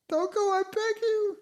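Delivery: falsetto, begging her not to go; high pleading queen voice. Acted. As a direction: plead in a high put-on woman's voice.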